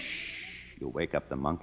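soft, sly shush; mock hush. Writer teasing the star to quiet down, whispered. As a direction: hush her with a joke.

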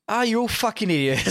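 high, scornful put-on voice; mock frustrated outburst. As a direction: scoff at everyone like a spurned artist. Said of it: "and then you're like".

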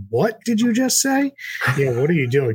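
mock-baffled high question; incredulous friends. Friends from home reacting to his Southern y'all, mock-incredulous. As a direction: play the puzzled friends from home. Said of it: "my friends from home are like".

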